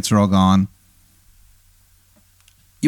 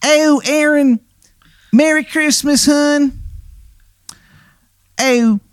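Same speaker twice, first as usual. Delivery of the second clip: high, hammy Baltimore "hun" voice; the worst Maryland accent you've ever heard; bad Maryland accent; slower than usual. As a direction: play a ghost with a terrible Maryland accent, way up high. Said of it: "the worst Maryland accent".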